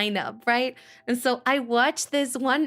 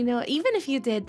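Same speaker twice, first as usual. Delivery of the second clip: ordinary talk, nothing marked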